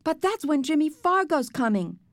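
high, cracking protest. A narrator's reading. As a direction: pitch it up until the voice cracks. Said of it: "I heard my voice crack".

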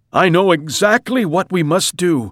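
high, emphatic, taking charge; urgent husband's voice. A narrator's reading. Mister Watson to his crying wife, urgent declaring voice. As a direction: announce a plan in an emergency.

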